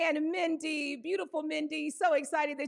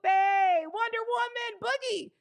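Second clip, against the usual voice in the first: excited announcing; 7 semitones higher, 3 dB louder than usual. Real, not acted. Hyping a viewer's arrival.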